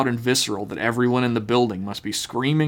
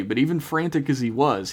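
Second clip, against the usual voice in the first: normal talking voice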